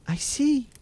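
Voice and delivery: high-pitched voice of the watching sister; Sister Anne. A narrator's reading. Sister Anne reporting from the tower, high-voiced reply. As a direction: answer from above in a high voice.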